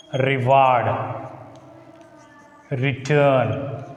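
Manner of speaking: define, delete, debate each weighted at the end; teacher lists words with end stress; stress on second syllable. Shown as a demonstration. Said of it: "words that begin with re".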